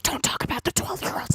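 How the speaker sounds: whispered, unvoiced mocking aside. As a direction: hiss it like a guilty secret. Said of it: "how he's like".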